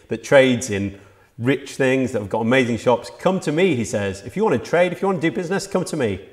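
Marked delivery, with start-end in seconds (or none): 3.2-3.7 high, ringing call to come; Jesus calling out. Acted. Preacher voicing Jesus inviting a trading city, high calling out. 4.2-6.3 high, ringing call to come; Jesus calling out. Acted. Preacher voicing Jesus inviting a trading city, high calling out.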